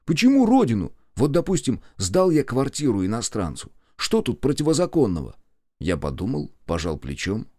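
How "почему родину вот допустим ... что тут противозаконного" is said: high-pitched protest, starting loud; indignant self-defence. Acted. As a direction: argue back, pitched up and aggrieved. Displeased boss defends himself. (возмущённо оправдываясь)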